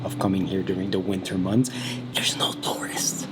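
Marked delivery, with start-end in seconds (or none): 2.1-3.3 hushed, slow whisper. Real, not acted. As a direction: lean in and whisper it. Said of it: "whispered aside in empty baths".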